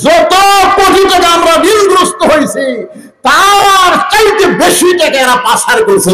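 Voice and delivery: very high, sustained pulpit shout; full-force preaching. Real, not acted. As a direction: cry out the accusation to the whole crowd.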